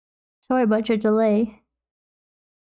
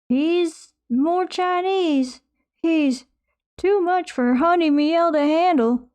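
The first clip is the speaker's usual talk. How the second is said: higher, drawn-out quoted line; quoting a movie line; 6 semitones higher, much slower than usual. Retold or imitated. Streamer quoting a movie, higher mock voice. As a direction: ham up a movie quote with a put-on voice. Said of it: "I'm quoting Incredibles".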